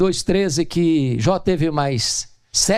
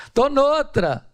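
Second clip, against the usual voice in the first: laughing brush-off; 10 semitones higher, much slower than usual. Pretend. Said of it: "Eu diria".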